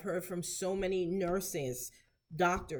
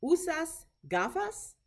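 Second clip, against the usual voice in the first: "¿Usas gafas?" said slowly, higher, voice going up at the end; slow demo question, lifting the pitch on "gafas?"; rising question tone; 9 semitones higher than usual. Shown as a demonstration. Teacher modelling a question for clinicians, "gafas?" rising at the end. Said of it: "how my voice goes up at the end".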